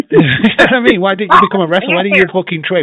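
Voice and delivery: loud, high ranting disbelief; worked-up podcast rant about wrestling; angry rant. Real, not acted. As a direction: shout it out in outrage. Ranting about [name].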